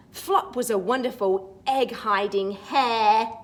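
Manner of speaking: put-on lively read-aloud for children; storybook reading. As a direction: read it like a bedtime picture book. Reads the picture book rhyme.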